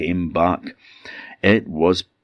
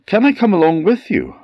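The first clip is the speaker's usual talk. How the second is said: high character voice; 14 semitones higher, 5 dB louder, faster than usual. Acted. Man asks to join the boat.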